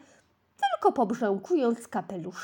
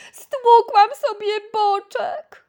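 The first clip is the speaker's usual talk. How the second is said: high, loud slow whining complaint; whimpering moan; 11 semitones higher, 9 dB louder, slower than usual.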